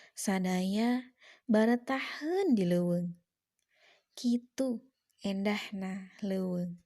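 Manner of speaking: measured declamation with long pauses between lines; poem recitation. Shown as a demonstration. Teacher reciting a forest poem for pupils, declaimed poetry reading. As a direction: recite it as a poem, swelling on key words, settling at the end.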